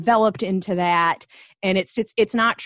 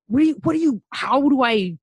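higher, louder flustered protest; exasperated mock reply; 5 semitones higher, 5 dB louder than usual. Host retelling her exasperated answer to the school question. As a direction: sputter it in disbelief.

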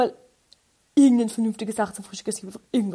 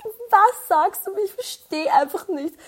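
much higher, slow baffled plea; mock pleading with the mumbling clerk; exasperated; 10 semitones higher, 5 dB louder, slower than usual. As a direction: drag it out in confused despair. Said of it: "Ich so".

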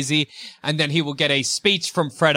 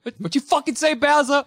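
much higher, hammed-up threat; high mock tough Peach; 10 semitones higher than usual.

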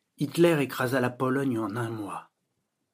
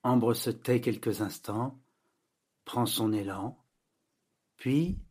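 usual speaking voice

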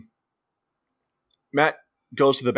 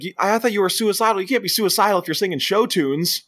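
mock accusing; 8 semitones higher than usual. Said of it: "He's like".

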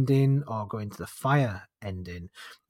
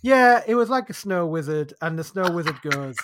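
ordinary talk

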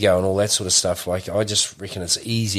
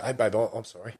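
softer, tender "Hey, babe, I'm sorry"; soft, sincere apology to his wife; 8 dB quieter than usual. Retold or imitated. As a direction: gently make peace with the wife.

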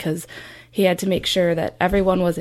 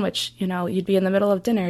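ordinary talk

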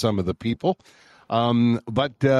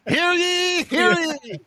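town crier; slower than usual. Pretend. Mock town crier call.

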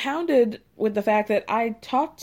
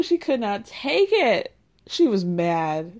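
normal talking voice

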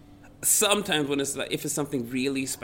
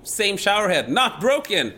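louder and higher, outraged at the punchline; indignant outburst; 9 semitones higher, 8 dB louder than usual. Real, not acted. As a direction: blurt it out in disbelief.

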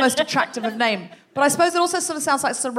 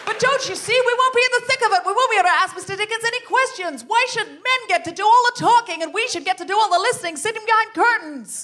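much higher, righteous indignation; indignant protest; 10 semitones higher, 3 dB louder, faster than usual. Acted. Ringleader protests the curtain to friends, indignant and high. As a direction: demand to be let in. Jenny June protesting exclusion.

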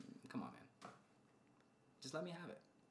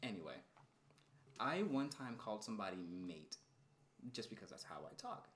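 ordinary delivery

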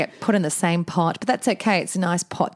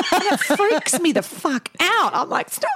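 comic freak-out. Real, not acted. Co-host anxious about catching COVID, comic freak-out. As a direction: shoot the pitch up, half laughing. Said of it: "I actually get quite anxious".

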